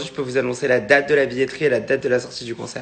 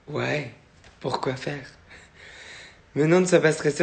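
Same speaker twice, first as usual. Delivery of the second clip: usual speaking voice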